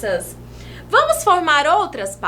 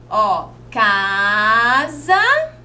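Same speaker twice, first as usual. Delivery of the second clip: slow demo word; 5 semitones lower, much slower than usual. Shown as a demonstration. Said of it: "mais um espaço em branco aqui".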